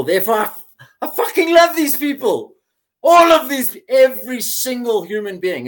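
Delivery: high, loud, laughing exclamation of love; laughing, near-shouting elation; ecstatic love. Retold or imitated. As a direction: burst with joy for everyone. Retold epiphany of love.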